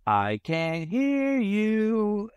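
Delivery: slow, high, drawn-out cartoon captain call; mock cartoon intro shout; SpongeBob intro captain. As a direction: stretch it like the cartoon pirate teasing the kids. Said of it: "play the sound from the SpongeBob intro".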